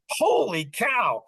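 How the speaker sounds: high, drawn-out exclamation; hammed-up slow wonder; retold astonishment. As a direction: stretch it out high, acting amazed. Retelling waking with a sudden idea.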